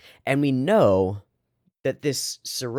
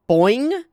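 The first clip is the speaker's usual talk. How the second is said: loud, high boing rising at the end; spring the pitch upward like a bounce; bouncy boing; 13 semitones higher, 6 dB louder than usual. Shown as a demonstration. Streamer imitating the camera bounce, playful boing.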